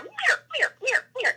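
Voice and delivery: high-pitched falling elk mews; high elk mewing; imitated elk cow calls. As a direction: mimic short, squeaky cow-elk calls. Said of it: "all of a sudden you hear".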